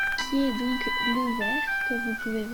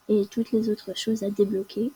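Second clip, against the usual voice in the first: ordinary delivery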